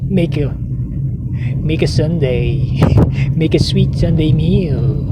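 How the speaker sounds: dopey president impression, dragging out the words; McDonald Trump. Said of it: "that's the way he speaks".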